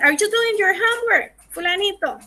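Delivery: high-pitched parental check-up; mother calling child. Acted. Teacher as mother asks fulanito about homework, high-pitched calling. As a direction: ask your kid in a lifted voice. Mother's question voiced in English.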